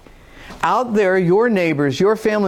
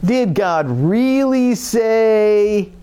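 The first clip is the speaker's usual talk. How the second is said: slow, drawn-out doubting whine; whiny condescending tone; 4 semitones higher, much slower than usual. Retold or imitated. A doubter questioning a believer's faith, slow whiny sneer. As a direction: stretch each word with a sneer. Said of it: "that whiny little condescending tone of voice".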